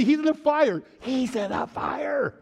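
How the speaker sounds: high-pitched cry, drawn out on the repeat; excited exclamation. Real, not acted. Preacher affirming Jesus is in chapter three, excited. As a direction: exclaim it with mounting glee. Excited echo of audience's answer.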